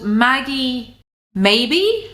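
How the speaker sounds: slow rising American question tune on "Maggie Maybe?"; American uptalk. Retold or imitated. Youtuber mocking a woman's American uptalk. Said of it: "that American kind of inflection".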